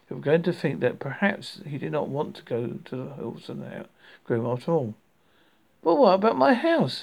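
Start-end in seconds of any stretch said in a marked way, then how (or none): 5.8-7.0 plaintive, pitched high and loud; high-pitched plaintive objection; plaintive protest. A narrator's reading. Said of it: "he said privately".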